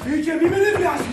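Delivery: loud, high-pitched angry warning; worked up. Acted. Boss losing his temper with Manolis, worked up. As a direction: blow up at him, voice jumping up. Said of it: "Ηρέμησε".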